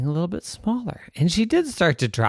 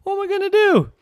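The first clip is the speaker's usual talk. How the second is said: worried high; 4 dB louder, faster than usual. Acted. Trapped girl worried.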